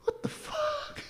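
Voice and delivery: tiny, very high hushed squeal; stifled disbelief. Retold or imitated. Diner reacting to plates breaking in quiet room, squeaked disbelief. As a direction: squeak it softly, on the edge of laughing.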